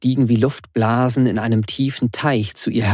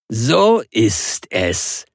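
booming triumphant; much slower than usual. Acted. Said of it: "dröhnte ihr Vater triumphal".

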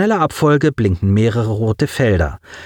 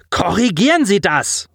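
high, sharp order; snapping angrily; 9 semitones higher than usual. A narrator's reading. Commander to failing officer, angry snapped order. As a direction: lash out at the officer. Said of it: "fuhr Gugis ihn an".

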